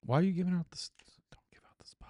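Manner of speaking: hushed, whispered scolding; barely voiced, secretive complaint; whispered aside. Real, not acted. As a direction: whisper it so it stays between you. Hushed aside about giving away spots.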